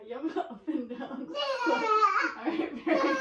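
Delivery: breaking into loud laughter; laughing. Real, not acted. Examiner cracks up during exam.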